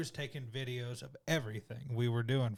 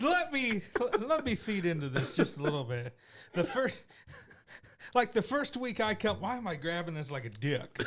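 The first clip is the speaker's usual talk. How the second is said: laughing; 7 semitones higher than usual. Real, not acted. Telling story while laughing.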